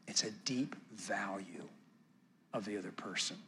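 very soft, low, near whisper; hushed, intimate sincerity; tender hush. Real, not acted. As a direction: let the voice sink into a reverent hush. Tender point about valuing someone.

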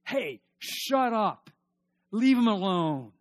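slow, loud, high scolding; rebuking thief. Acted. Thief on the cross rebukes the other thief, slow and loud. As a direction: bark it down at the mocker.

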